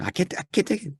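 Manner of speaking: high, strained mock protest; mock exasperation. Listener pulling out the earbuds, mock exasperated. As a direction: act out a listener who can't bear it. Retold recoil from unbearable narration.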